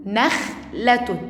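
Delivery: نخلة slowly, stopping on the kh before the second syllable; sukun demonstration. Shown as a demonstration. Teacher showing first graders sukun, slow split reading. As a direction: hold the kh in نخلة, then finish. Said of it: "وعلامة السكون".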